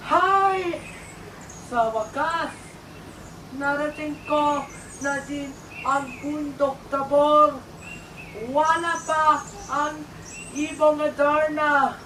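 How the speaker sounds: high, loud acted exclamation; loud arrival call.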